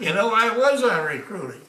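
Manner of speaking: loud laughing reply. Real, not acted. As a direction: blurt it out through a laugh. Amused reply to recruiter joke.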